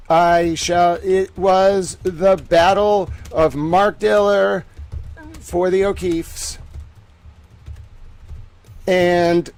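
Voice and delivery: loud, slow announcer read. Acted. Streamer announcing the hearing to people on Twitter, loud announcer voice. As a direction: draw out each word like a big fight announcer.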